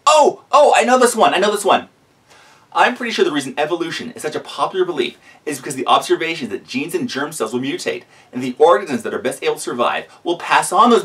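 loud, high, eager know-it-all; bursting to answer like a keen pupil; eager excitement. Real, not acted.

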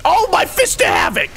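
high-pitched outraged yell; upset cry. Real, not acted. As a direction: cry out over a botched super. Failed super in the game.

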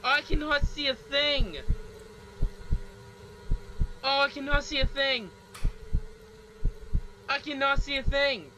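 frantic cry. Real, not acted. Repeated cry in dark.

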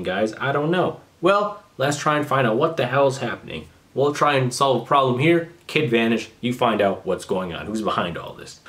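usual speaking voice

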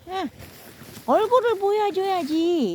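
ordinary talk, nothing marked

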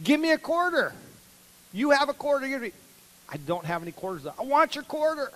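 put-on boy demanding his sister's quarter; demanding kid. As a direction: insist like a bratty kid.

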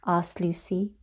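usual speaking voice

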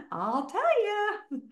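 slow, high laugh through the words. Real, not acted. Coach laughing at her own question, teasing. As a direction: chuckle it out slowly.

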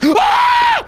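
sharp gasp into a high scream; jump-scared shriek. Real, not acted.

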